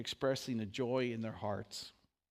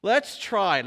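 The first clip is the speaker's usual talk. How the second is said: excited lead-in; 5 semitones higher, 12 dB louder than usual. Real, not acted. Animated lead-in to singing.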